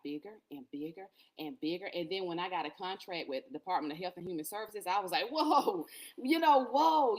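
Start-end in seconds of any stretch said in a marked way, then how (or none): 5.3-7.2 high, loud burst of astonishment; amazed whoa. Retold or imitated. Podcaster retelling her reaction to a big contract, amazed. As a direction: relive being bowled over, drawing out the last whoa.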